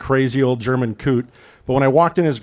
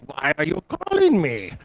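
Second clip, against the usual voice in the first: put-on heavy German accent, suspicious; old German man, annoyed on the phone; thick German accent; 2 semitones higher than usual. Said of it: "Old German, thick accent".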